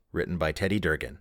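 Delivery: ordinary talk, nothing marked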